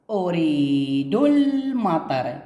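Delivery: أريد المطر drawn out slowly, ال not sounded; very slow reading. Shown as a demonstration. Teacher shows silent hamzat al-wasl to pupils, very slow. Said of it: "نشوف هنا الجملة الأخرى".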